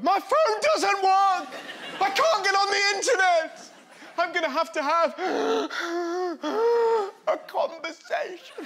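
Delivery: hammed-up despairing wail; mock panic. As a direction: whine like a spoilt child. Mocking phone-outage panic.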